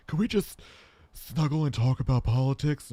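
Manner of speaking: put-on voice starting high; strange woman's voice. Man imitating a past hookup asking to snuggle, strange voice. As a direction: mimic an odd woman pleading for company. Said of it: "she had a very strange voice".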